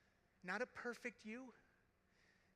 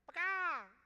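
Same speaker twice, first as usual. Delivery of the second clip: comic exclamation; 11 semitones higher, 10 dB louder than usual. Acted.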